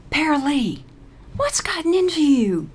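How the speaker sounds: plain reading voice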